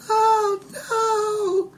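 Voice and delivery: very high wailing cries, stretched out; woman screaming voice. Acted. Game master as creature crying for rescue, high screaming woman voice. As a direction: creature mimicking a distressed woman. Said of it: "same kind of woman screaming voice".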